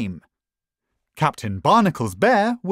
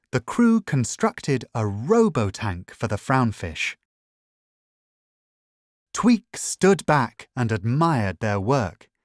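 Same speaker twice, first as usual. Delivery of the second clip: usual speaking voice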